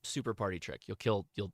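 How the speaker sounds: normal talking voice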